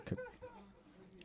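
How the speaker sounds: a breathy unvoiced "Could be"; slyly hinting at the gossip; whispered tease. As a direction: whisper "Could be" like a secret hint.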